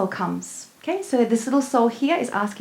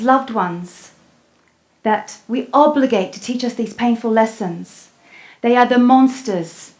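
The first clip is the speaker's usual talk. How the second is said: louder, pressing sermon voice; impassioned preaching; 5 dB louder than usual. Acted. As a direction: drive the lesson home to the crowd. Preaching the lesson.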